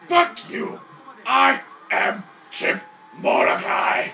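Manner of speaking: very loud, slow roar of his own name; hammed-up action-hero war cry; heroic battle shout. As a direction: scream it at the beasts. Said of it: "I said".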